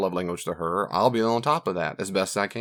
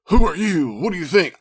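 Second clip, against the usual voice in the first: sharp, high, loud mock snap; mock indignant outburst; 8 semitones higher, 8 dB louder than usual. Husband mocking his own angry reaction to wife, indignant. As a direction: play the offended husband, half-joking.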